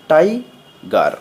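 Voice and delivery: Tiger slowly, split into two beats; broken into syllables. Shown as a demonstration. Teacher showing two syllables, tiger broken in half. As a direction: pause in the middle of tiger. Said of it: "ভেঙে ভেঙে উচ্চারণ করতে হয়".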